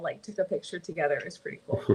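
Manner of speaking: normal talking voice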